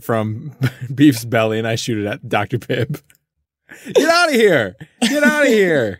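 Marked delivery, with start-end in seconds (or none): 3.9-6.0 high, half-laughing yell; shooing him off. Acted. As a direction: drive him away, playfully. Driving Doctor Pibb away.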